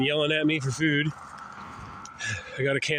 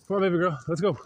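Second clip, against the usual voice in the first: higher-pitched call to a pet; coaxing dog call; 4 semitones higher, much faster than usual. Real, not acted. Owner calling his baby girl to go, coaxing. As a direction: coax the dog to get moving.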